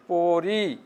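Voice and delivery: পড়ি stretched, its final ই held; drawn-out ending. Shown as a demonstration. Teacher showing students how to pronounce it, পড়ি drawn out. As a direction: hold the last vowel of পড়ি long. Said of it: "রস্বই উচ্চারণ হচ্ছে".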